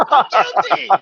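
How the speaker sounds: high-pitched, over-the-top confession; mock guilty confession. Guilty husband begging his wife's pardon, hammed-up pleading. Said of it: "you can't be that guilty".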